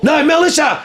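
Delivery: high-pitched, flustered self-correction; retold frantic correction. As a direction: blurt out the fix in mock panic.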